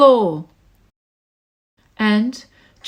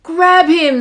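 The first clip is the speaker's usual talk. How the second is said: louder gang shout; 4 semitones higher, 6 dB louder than usual. Acted. Wickersham gang attacking Horton, a louder shout. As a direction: bark the order. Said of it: "they shouted".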